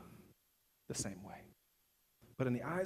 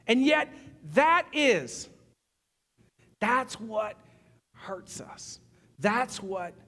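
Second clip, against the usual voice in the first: loud, higher, driving sermon voice; full-force preaching; 12 semitones higher, 12 dB louder than usual. Acted. Preacher warns the congregation about ranking sin, at full force. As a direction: push the warning about sin hard.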